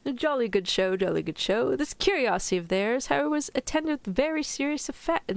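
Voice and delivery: jolly, amateur British accent; mock-British reading, badly faked; attempted British accent. Shown as a demonstration. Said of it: "I can't really do a British accent".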